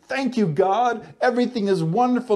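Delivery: breezy, falsely upbeat thank-you; lightly mocking cheerful prayer. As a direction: brush it off like all is fine. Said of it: "he's not saying".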